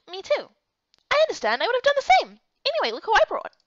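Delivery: a high, worried friend voice; concerned friend. Acted. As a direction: press gently but insistently. Same character voice.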